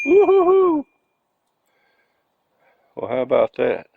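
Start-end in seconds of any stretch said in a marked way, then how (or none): 0.0-0.9 high, loud cheer; excited whoop. Real, not acted. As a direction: whoop with delight. Bulb starts blinking.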